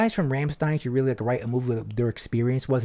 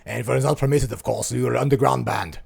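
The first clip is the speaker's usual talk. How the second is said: put-on German-accented rock singer; 3 dB louder than usual. Podcaster telling of the East German punk scene, German accent. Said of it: "this sounds like [name]".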